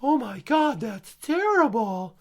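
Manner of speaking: high, slow scandalized gasp; high mock horror, drawn out; mock pearl-clutching. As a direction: pretend to be shocked.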